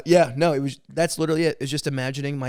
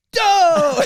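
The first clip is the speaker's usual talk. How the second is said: sudden shout; 10 dB louder than usual. Retold or imitated. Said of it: "And then she'll go".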